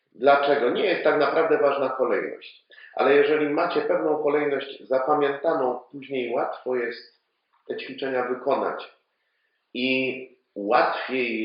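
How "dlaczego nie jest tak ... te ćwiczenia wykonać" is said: ordinary talk, nothing marked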